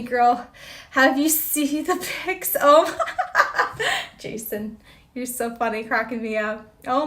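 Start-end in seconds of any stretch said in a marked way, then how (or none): 3.0-4.1 real laugh, high and bright; high burst of laughter. Real, not acted. Viewer joke cracks her up, high real laughter.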